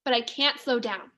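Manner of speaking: plain reading voice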